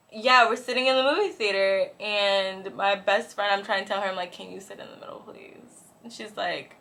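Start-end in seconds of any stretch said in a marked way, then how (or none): 4.3-5.9 very soft, half-whispered request; hushed plea. Retold or imitated. Asking best friend to switch seats, hushed. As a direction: whisper a discreet favor to a friend.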